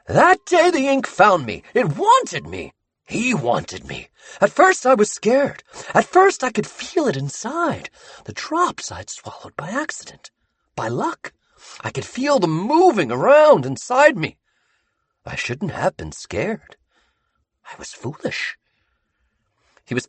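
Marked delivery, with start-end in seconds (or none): 0.1-16.8 high, feverish voice rising and sinking; a madman recalling his possession with awe; obsessed Sammy confessing. Acted. Sammy telling the bound boys how the ink found him, feverish. 17.7-18.6 whispered. Acted. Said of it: "whispered confession".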